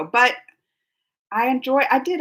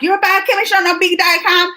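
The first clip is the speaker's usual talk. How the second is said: higher, louder incredulous jab at herself; mock disbelief; 5 semitones higher, 6 dB louder than usual. Reading a viewer's teasing comment, mock incredulous. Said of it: "She's like".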